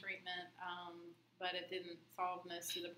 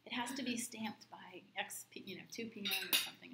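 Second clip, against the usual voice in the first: half-whispered, hushed retelling; mock whisper back to the clerk; retold whisper; 3 semitones higher, 7 dB louder than usual. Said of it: "And I whisper back".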